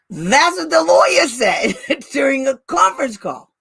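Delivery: high, disbelieving outburst with a laugh; incredulous laughing exclamation. Real, not acted. Advocate reveals what the lawyer said, incredulous laughter.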